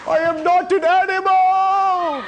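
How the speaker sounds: high, slow, drawn-out wail; character voice. Acted. Elephant Man protests to jeering children, high slow wail. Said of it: "You're doing characters".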